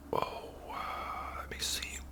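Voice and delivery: ordinary talk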